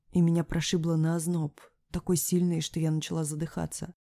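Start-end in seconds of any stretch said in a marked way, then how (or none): none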